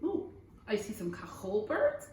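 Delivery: usual speaking voice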